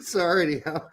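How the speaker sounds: usual speaking voice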